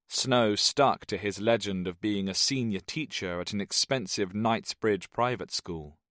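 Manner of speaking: plain reading voice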